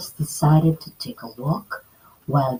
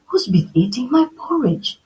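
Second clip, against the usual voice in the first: whispered, worried mother bear; Mama Bear, whispered; 6 semitones higher than usual. Acted. Mama Bear noticing her porridge, whispering. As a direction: ask it under your breath. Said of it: "asked Mama Bear".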